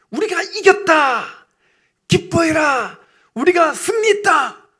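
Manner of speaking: high, loud, drawn-out cries; victory shout. Acted. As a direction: shout the triumph to the whole city. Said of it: "그때 외쳤던".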